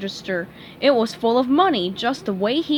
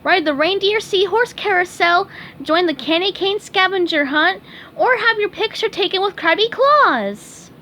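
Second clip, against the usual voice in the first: SpongeBob voice; 8 semitones higher, 4 dB louder than usual. Acted. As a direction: call out like a cheery head elf. SpongeBob calls to children.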